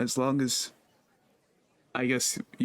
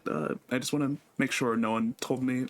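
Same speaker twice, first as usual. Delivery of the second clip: ordinary talk, nothing marked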